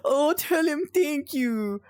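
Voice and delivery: high, slow, sweet put-on voice; imitating Peach. Relaying Peach's thanks to a viewer, high cooing voice. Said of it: "Peach said".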